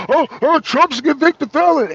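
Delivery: high, loud mock outcry; exaggerated hand-wringing, mimicking the critics; mock alarm. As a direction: raise the pitch and volume in mocking panic. Mockingly quotes critics' alarm.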